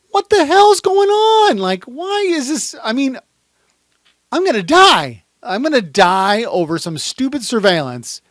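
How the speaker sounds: high, loud outburst of disbelief; panicked yelling. Acted. Investigator seeing a handgun, yelling in panic. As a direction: cry out as if about to die.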